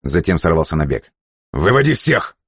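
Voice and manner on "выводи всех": barking orders at a subordinate; snapped order. A narrator's reading. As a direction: bark it while running. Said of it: "рявкнул он".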